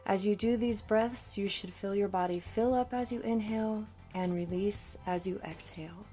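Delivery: ordinary talk, nothing marked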